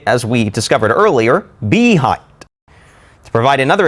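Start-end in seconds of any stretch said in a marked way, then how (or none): 1.7-2.2 behind turned into BEE-hind, high on BEE; stress on first syllable. Shown as a demonstration. Teacher switching the stress mark, BEE-hind stressed up front. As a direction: hit the beginning of "behind". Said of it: "switch the stress mark to the beginning".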